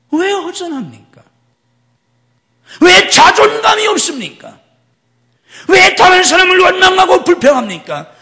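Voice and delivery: loud, high fiery preaching; preaching at full force. Acted. Pastor confronting the congregation with loud preaching. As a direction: hammer the questions at the congregation.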